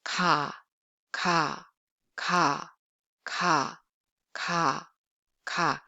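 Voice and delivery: "Ka" with no tenseness, between k and g; plain Korean k in "ka", repeated evenly; soft lax k. Shown as a demonstration. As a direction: say "ka" low and relaxed, little puff. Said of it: "voiceless sound with no aspiration and tenseness".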